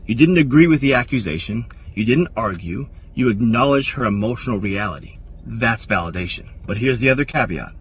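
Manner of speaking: ordinary talk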